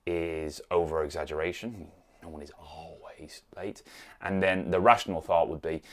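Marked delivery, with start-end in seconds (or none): 2.2-3.8 soft unvoiced whisper. Shown as a demonstration. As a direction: breathe it out as a calm aside. Rational reply to negative thought.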